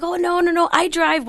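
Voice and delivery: put-on high little kid voice; imitating a small boy; child voice. Kid answering the speaker.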